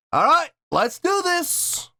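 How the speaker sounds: very high, drawn-out rallying call; hyped kickoff. Real, not acted. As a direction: launch it with a big, slow, high-pitched whoop of words. Hyping up before playing video.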